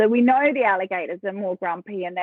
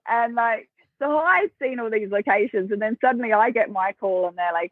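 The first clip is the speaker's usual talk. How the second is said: normal talking voice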